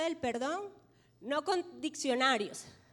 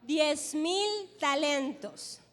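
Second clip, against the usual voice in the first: drawn-out, very slow; slowed right down to lead the congregation's echo; slow call for the church to repeat; much slower than usual. Shown as a demonstration. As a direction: stretch each word so the church can follow. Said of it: "Toda la iglesia diga".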